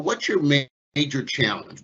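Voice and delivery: normal talking voice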